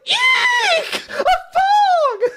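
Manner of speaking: very high, loud mock shriek of horror; fifties housewife shriek. Yuega hit by falling underwear, high mock housewife shriek. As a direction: squeal it like a prim fifties housewife seeing a mouse. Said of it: "like a nineteen fifties housewife".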